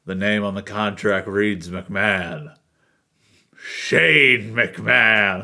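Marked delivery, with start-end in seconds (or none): 3.6-5.4 slow, loud ring announcer call; announcer shout. Pretend. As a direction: boom it out like an announcer. Dramatic announcement of name.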